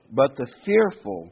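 fearful said slow, high and loud; stress on fearful. Shown as a demonstration. Preacher reading scripture, stressing fearful, slow and loud. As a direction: lean into fearful, slowly. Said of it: "I want to emphasize the word fearful".

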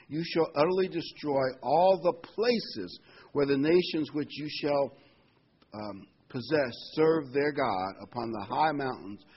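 preacher reading the command aloud; scripture reading. Acted. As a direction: proclaim God's command.

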